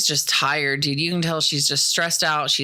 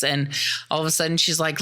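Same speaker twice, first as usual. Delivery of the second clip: ordinary delivery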